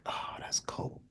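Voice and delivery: barely audible, whispered reaction; stunned whisper. Retold or imitated. Wounded boy reacts to the cop's callousness, stunned whisper. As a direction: breathe it out under your breath.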